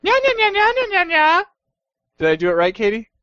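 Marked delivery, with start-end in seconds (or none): 0.1-1.4 high squeaky cat mewing; cutesy nya speak. Pretend. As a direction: mew it like a playful kitten. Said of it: "maybe that means I should just do it".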